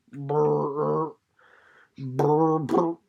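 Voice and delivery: low trilling bullfrog croak. A narrator's reading. Narrator imitates a bullfrog at ease on the banks, low croaking trill. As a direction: roll a low croaking brr. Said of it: "the trilling bass note of an astonished bullfrog".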